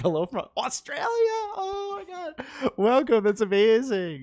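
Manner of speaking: high, loud delighted outburst; real excitement. Real, not acted. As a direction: greet the viewer with thrilled surprise. Delighted greeting to far-off live viewer.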